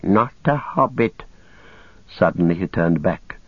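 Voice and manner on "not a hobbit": higher, slow, suspicious creature; Gollum's creature voice. Acted. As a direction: draw it out warily in a creature voice. Gollum senses a stranger.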